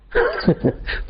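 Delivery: brief high giggle, acted; woodcutter's laugh. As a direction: break into a short squeal of laughter. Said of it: "द्वारपाल को देखकर कठियारा हंसा।". (छोटी ऊँची हँसी)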